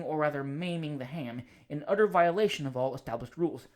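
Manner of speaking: plain reading voice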